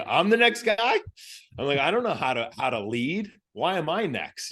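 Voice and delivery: high, loud shocked squeak; can't believe it's you; panicked disbelief. Retold or imitated. Panicked disbelief at being picked.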